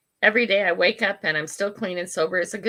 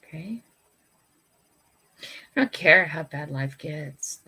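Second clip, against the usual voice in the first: normal talking voice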